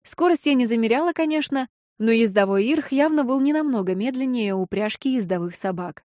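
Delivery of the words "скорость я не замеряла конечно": plain reading voice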